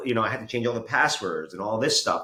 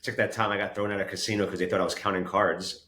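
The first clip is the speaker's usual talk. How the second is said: ordinary talk, nothing marked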